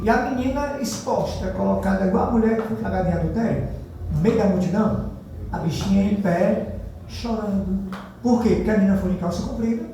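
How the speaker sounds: ordinary talk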